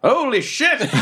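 high laughing exclamation; stunned and laughing; shocked laugh. Real, not acted. Player seeing Kevin turn to ash, high laughing shock.

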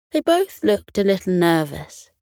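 plain reading voice

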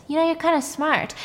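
creepy boss praising his employee. Retold or imitated. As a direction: flatter her with menace. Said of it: "the boss looks at Jamie and says".

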